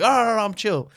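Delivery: retold hurried backing-down; frantic placating. Acts himself pleading from the headlock.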